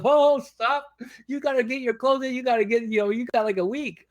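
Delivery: high-pitched imitation of an excited caller; urgent interruption. Caller halting a screaming contestant to get him packing, urgent.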